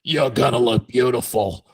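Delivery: creepy cigarette-smoker rasp; rough smoky pitchman voice; gravelly voice. Retold or imitated. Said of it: "that creepy, like, cigarette voice".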